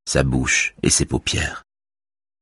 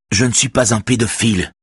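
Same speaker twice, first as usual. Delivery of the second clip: plain reading voice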